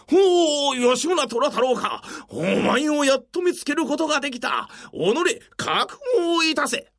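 shouting soldier. Acted. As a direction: bark it out as a soldier. Soldier confronts Yoshimura.

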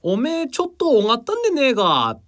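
grandma speaking broad Tsugaru dialect; high, rustic Tsugaru grandmother voice. Retold or imitated. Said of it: "ちょっとやってみて".

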